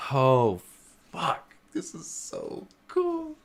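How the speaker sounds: high, slow, awestruck; awed. Real, not acted. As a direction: breathe it out in awe. Awed at discovery.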